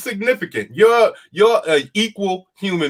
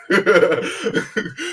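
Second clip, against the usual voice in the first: laughing; 4 semitones higher than usual. Real, not acted. Laughing at own mockery.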